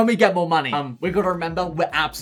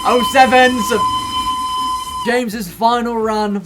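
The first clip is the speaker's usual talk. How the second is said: excited; 4 semitones higher, 5 dB louder than usual. Real, not acted. Excited at the station.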